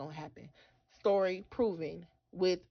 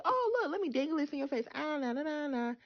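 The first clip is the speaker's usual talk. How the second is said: lightly voiced, higher mock taunt; mock teasing God; 6 semitones higher than usual. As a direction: tease like dangling a prize. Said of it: "He's not a God that he's gonna say".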